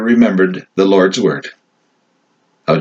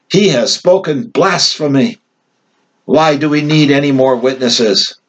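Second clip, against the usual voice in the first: outraged high priest; 6 semitones higher than usual. A narrator's reading. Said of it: "the high priest tore his robes".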